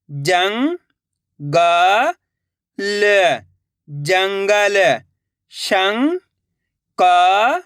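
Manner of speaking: first part slow and drawn out, then the whole word said plainly; patient teacher reading words for children; sound-out reading drill. Shown as a demonstration.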